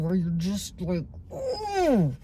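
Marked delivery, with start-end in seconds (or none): 1.3-2.2 high-pitched frustrated "ooh" noise; frustrated groan. Retold or imitated. Showing a friend how anger feels, frustrated groan. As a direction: imitate pent-up anger with a high squeal. Imitates the angry feeling she describes.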